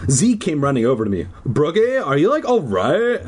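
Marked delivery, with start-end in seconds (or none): 1.5-3.2 lightly put-on higher valley voice; a hint of a dopey teen boy; Z's voice. Z asks Brooke if she's okay, lightly put-on dude voice. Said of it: "That was the voice".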